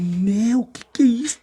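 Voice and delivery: high-pitched retold amazement; espanto revivido. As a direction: act out being stunned by the sight. Relembra espanto diante da multidão. (imitando espanto, com a voz aguda)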